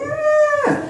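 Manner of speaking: very high, piercing yelp; scared kid's scream. Retold or imitated. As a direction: scream like a terrified little boy. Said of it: "they're, like, crying".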